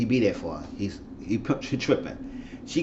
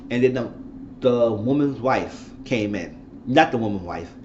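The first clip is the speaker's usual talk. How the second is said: ordinary delivery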